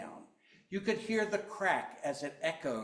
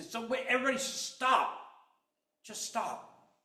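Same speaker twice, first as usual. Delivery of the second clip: firm call to stop; 10 semitones higher, 4 dB louder than usual. Retold or imitated. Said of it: "the best thing you can do is say".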